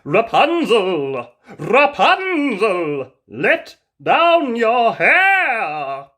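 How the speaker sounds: loud, slow, drawn-out calling; prince calling up. Acted. Prince calling up to Rapunzel's window, loud and drawn out. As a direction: call up to the tower window, stretching every word. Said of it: "called the prince".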